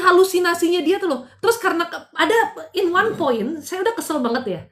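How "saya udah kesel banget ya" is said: ordinary delivery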